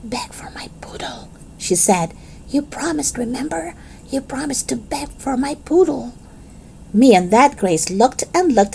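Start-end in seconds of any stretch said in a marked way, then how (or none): 0.0-1.3 unvoiced whisper. Girl whispers to her friends in the back seat, secret whisper. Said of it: "She whispered real quiet". 2.5-6.1 much softer urging; quiet whisper. Acted. Girl reminds friends of their promise, soft pressing whisper. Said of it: "She whispered real quiet".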